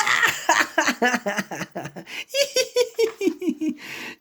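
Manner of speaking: a bright giggle that spills over; high giggling laugh. Acted. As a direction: giggle helplessly at the joke. Characters crack up at joke.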